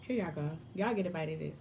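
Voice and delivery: ordinary talk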